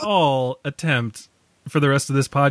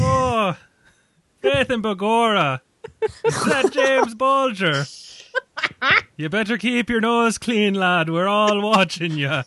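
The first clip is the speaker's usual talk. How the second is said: Irish cop; 5 semitones higher than usual. Acted. Irish cop warning a gangster on the street, thick Irish accent. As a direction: lay on a hammed-up Irish brogue. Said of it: "like an Irish cop".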